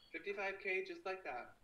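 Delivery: ordinary talk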